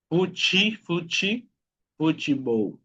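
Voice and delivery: "Fuchi, fuchi" rising, then "futebol" as fu-chi-bou; Portuguese pronunciation. Shown as a demonstration. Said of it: "sería futebol". ("futebol" por partes, con chi en medio)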